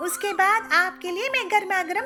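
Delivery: put-on high-pitched eager young bride voice; chirpy young bird. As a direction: show off the bargains, bright and high. Pilu answers mother-in-law.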